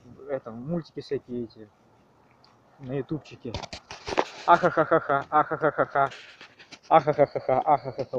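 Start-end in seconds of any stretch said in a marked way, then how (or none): 4.5-8.2 mechanical cartoon robot laugh. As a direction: cackle like a YouTube cartoon robot. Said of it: "Вот такой робот, такой вот голос робота".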